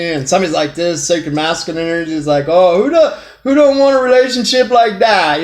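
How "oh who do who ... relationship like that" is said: higher-pitched, playful imagined reaction; mock eager admiration. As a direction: act out an impressed onlooker, pitched up. Acts out partner admiring the relationship.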